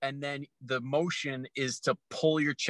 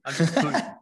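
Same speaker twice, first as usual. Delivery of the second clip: louder teasing "pooing" with the L dropped; mock Florida accent; 2 semitones higher, 5 dB louder than usual. Host teasing his co-host's panhandle pronunciation, mock drawl. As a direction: tease the co-host by copying his "poo". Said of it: "that's a Florida poo".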